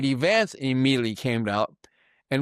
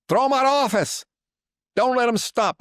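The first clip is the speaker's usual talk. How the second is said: higher, louder call to throw him out; rallying shout; 11 semitones higher, 5 dB louder than usual. Real, not acted. Commentator urges voters to act, in a loud, rousing rally shout. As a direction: shout it up to the crowd like a rally cry.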